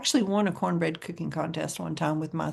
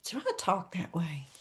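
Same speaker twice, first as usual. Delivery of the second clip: quiet self-question; 10 dB quieter than usual. Retold or imitated. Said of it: "I think".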